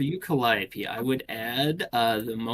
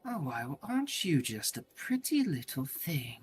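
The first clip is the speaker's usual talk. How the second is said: soft, higher, unsettling purr; creepy admirer; 4 semitones higher, 5 dB quieter than usual. Acted. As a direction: coo creepily at a newcomer. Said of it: "as he looks at you, he goes".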